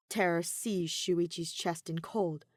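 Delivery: ordinary delivery, nothing marked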